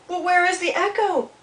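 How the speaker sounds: put-on high, eager young-animal voice; little beaver character. A narrator's reading. Little Beaver asking Old Beaver about the echo, high voice. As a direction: ask high and wondering.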